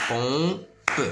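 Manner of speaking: "Pompe" split in two, final pe sounded; drawn-out two-beat "Pompe"; syllable by syllable. Shown as a demonstration. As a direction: sound out "Pompe" as pom, pause, pe. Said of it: "De deux syllabes plutôt".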